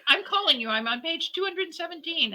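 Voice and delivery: high, eager retelling of the caller; excited caller. Retold or imitated. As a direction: bubble over like a thrilled new fan. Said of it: "she was saying".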